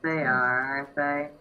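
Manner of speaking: ordinary delivery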